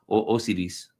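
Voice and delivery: Osiris tried Spanish-style, oh-SEE-rees, after a false start; hesitant Spanish-style Osiris, oh-SEE-rees; Spanish pronunciation. Shown as a demonstration. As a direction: say Osiris with an ee and a tapped r. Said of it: "How do you say that in, in Spanish".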